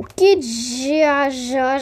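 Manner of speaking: very slow, stretched-out syllables in a character voice; drawn-out astonished reading. Acted. Ícaro and Saiko gaping at the strange figure, very slow. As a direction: stretch each syllable like frozen shock.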